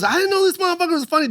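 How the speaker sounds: high, fast put-on protest; Rudy Rush. Host surprised by the newcomer, high quick impression. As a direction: rattle it off high and incredulous. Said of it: "he goes".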